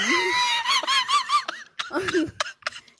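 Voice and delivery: loud, very high cackling laugh; burst of laughter. Real, not acted. Storyteller cracking up at the honey-pack tale, high laughter. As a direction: crack up helplessly.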